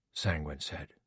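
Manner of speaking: ordinary delivery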